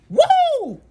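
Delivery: loud high woo of delight; thrilled cry; excited whoop. Real, not acted. Excited whoop after laughing.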